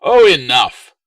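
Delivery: no-argument shout. A narrator's reading. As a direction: slam the discussion shut. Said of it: "Latius's tone brooked no argument".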